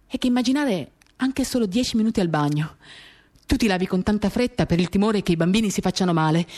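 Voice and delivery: ordinary delivery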